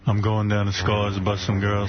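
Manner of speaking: deadpan cop boast; mock cop voice. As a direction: play a cocky cop. Imitating Shaq as cop.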